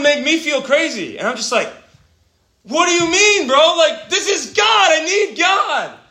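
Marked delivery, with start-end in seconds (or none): 2.6-6.0 high, impassioned protest; fervent, incredulous pleading; exasperated outburst. Retold or imitated. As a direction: raise the voice in disbelief, stretching the words. Retells exasperated outburst at prayer meeting.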